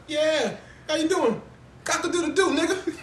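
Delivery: high, loud mock country hello; hammed-up country greeting. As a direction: crow it like a silly farm boy. Imitating Eddie Murphy's farm greeting.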